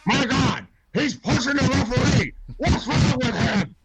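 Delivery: high, excited commentator call; wrestling announcer. Retold or imitated.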